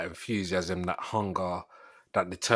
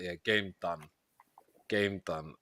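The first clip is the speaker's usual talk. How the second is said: resigned; 4 semitones lower, 9 dB quieter, slower than usual. Retold or imitated. Retold resigned reaction.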